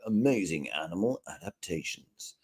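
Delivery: low, soft nature-documentary voiceover; hushed documentary narrator. Shown as a demonstration. Presenter trying out a title voiceover, hushed documentary narrator. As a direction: softly announce a wildlife title, low and understated. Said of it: "can you do it in a David Attenborough voice".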